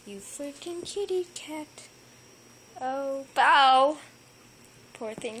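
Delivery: usual speaking voice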